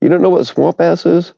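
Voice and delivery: can't believe they've never heard of it; incredulous. Real, not acted. Streamer to chat, disbelieving that someone doesn't know swamp ass.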